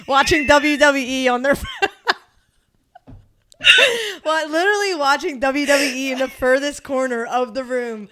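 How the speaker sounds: laughing loudly while telling it; laughing about EMTs watching wrestling. Real, not acted. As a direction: burst out laughing mid-sentence.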